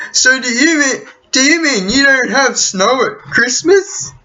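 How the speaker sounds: high, incredulous mock question; dumb American voice. Mocking a clueless American who asks about Christmas snow. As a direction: play the clueless tourist asking wide-eyed.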